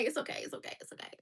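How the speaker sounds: fading whispered reassurance; soft whisper. Real, not acted. Soothing herself about her gap in a whisper. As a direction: whisper it to calm yourself. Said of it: "self-soothing".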